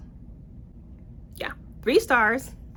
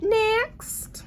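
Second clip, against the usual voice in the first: "Next" chirped much higher with a rise; squeaky chirp; 13 semitones higher than usual. Real, not acted. Reviewer brushing off a bad book, high chirpy next. Abruptly moving on.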